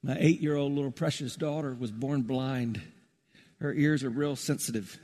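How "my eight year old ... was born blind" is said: ordinary talk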